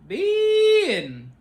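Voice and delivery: high loud sing-song call; mock the fake phone-voice call; customer service voice. Shown as a demonstration. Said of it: "it's so fake".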